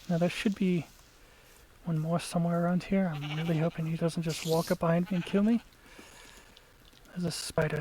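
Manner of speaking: ordinary talk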